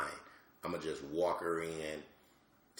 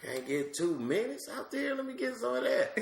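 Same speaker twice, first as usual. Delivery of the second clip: put-on very high, squeaky child voice; a toddler begging for the spotlight; high, higher on the last line; little daughter's voice. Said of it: "she looking at me like".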